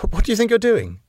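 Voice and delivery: high-pitched disbelief; incredulous. A narrator's reading. Husband challenges wife leaving her post, incredulous. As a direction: question it as if you can't believe it. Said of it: "with a little laugh of disbelief".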